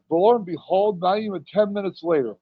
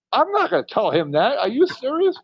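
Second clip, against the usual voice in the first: incredulous protest; 7 semitones higher than usual. Retold or imitated. Retold protest to Undertaker.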